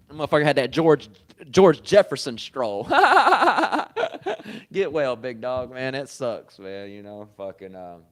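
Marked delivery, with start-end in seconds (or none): 2.9-4.7 high, loud burst of laughter. Real, not acted. Laughing at the memory.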